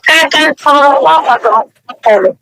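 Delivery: usual speaking voice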